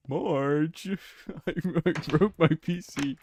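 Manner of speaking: badly faked cartoon dad, laughing; Homer Simpson. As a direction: call out as a hapless cartoon husband. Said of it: "I can't do a Homer Simpson impression".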